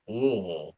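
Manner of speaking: Louisville mumbled as LUL-vul; swallowed Louisville. Shown as a demonstration. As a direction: swallow Louisville into one blurred lump. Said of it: "I always say it more like Louisville".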